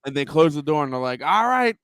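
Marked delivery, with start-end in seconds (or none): 1.2-1.8 mock crew voice. Acting out the sub crew.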